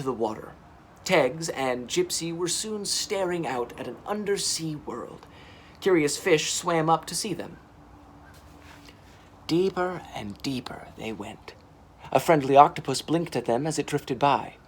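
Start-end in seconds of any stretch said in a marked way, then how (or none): none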